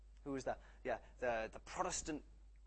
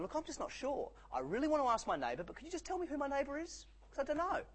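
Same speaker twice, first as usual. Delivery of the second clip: higher, lightly hammed-up naive asker; mock innocent asker; 12 semitones higher, 3 dB louder than usual. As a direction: play dumb and wide-eyed, mock-innocent. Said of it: "the innocent asker who's going".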